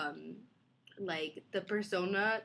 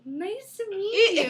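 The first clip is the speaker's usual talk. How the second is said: high, slow, louder mom voice; mom impression; 10 semitones higher, 7 dB louder, slower than usual. Mom meeting daughter's unlikely fiancee, mock-polite greeting. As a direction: greet them in drawn-out, pitched-up politeness. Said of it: "And she'd be like".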